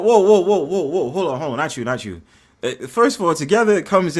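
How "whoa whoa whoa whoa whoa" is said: loud interrupting whoa. Real, not acted. Stopping the other speaker.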